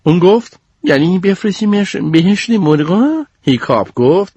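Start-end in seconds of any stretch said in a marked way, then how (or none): 0.8-3.3 slow, high mocking question. Goldie mocks the plan for chicken heaven, slow and high. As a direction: draw out the sarcasm.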